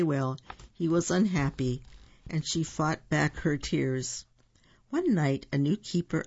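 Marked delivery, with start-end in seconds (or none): none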